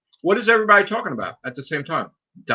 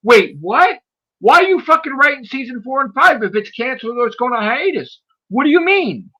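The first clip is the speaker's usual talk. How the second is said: higher, baffled questioning; mock disbelief; 7 semitones higher, 3 dB louder than usual. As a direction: demand answers, stunned and confused. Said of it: "so you and I are like".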